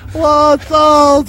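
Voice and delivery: high, slow, ditzy phone greeting; dingbat phone voice. Retold or imitated. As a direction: mimic a scatterbrained receptionist, drawn out. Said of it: "She'd say".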